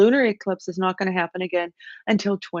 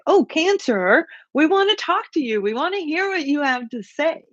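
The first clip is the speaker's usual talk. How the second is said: eager welcoming group; 8 semitones higher than usual. Pretend. Quoting an eager group inviting Cancer.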